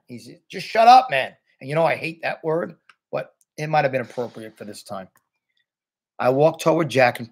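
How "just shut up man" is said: high, louder retelling of an outburst; fed-up imitation. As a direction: act out someone who has had enough. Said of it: "Jack had had it at this point".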